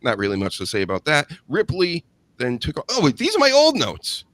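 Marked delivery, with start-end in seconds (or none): 2.9-4.2 high, loud jolt of realisation; sudden alarm. Real, not acted. Host catches himself reading wrong notes, startled and high. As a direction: break off in high-pitched alarm.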